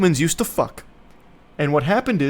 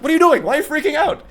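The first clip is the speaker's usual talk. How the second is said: mock alarmed mom; 12 semitones higher, much faster than usual. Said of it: "So the mom's like".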